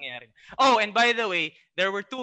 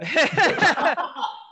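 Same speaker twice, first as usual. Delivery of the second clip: loud laugh; 11 semitones higher, 7 dB louder than usual. Real, not acted. Laughing at host's joke.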